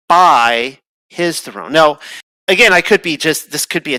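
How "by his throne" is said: slow, with BY stressed and held. Shown as a demonstration. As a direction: lean on BY in "by his throne". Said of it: "it says in the Hebrew this".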